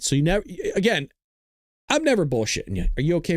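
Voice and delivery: usual speaking voice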